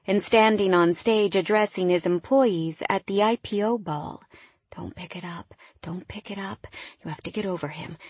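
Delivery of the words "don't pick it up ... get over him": very soft inner voice; self-coaxing murmur. A narrator's reading. Woman telling herself to get over her ex, hushed. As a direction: murmur it to yourself like a private warning. Urging herself not to text ex.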